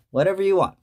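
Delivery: usual speaking voice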